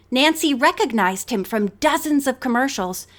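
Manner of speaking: usual speaking voice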